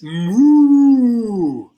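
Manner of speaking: long, loud, high-pitched moo; cow moo. Shown as a demonstration. Music teacher giving children an animal to guess, cow moo. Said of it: "an animal that goes like this".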